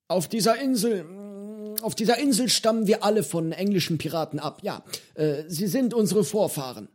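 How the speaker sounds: put-on a cheerful eccentric old man offering fish; old pirate Johnny. Old pirate cook to hungry boy, cheerful and high.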